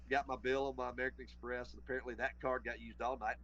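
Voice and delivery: normal talking voice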